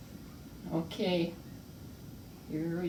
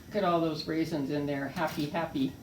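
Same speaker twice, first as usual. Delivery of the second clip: normal talking voice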